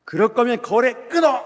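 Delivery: put-on yelled high and loud, an old boss blowing his top; shouted angry ultimatum; furious shout. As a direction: bark it like an enraged client cutting ties. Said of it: "뚜껑이 열렸어요".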